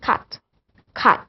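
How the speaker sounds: British CUT with the final T sounded; British accent. Shown as a demonstration. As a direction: finish cut on an audible T, British style. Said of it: "in British accent it's".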